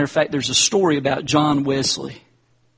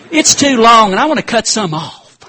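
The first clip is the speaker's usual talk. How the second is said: loud, higher mock scolding; punchline rebuke; 9 semitones higher, 10 dB louder than usual. Wesley rebuking the critical lady, loud mock scold.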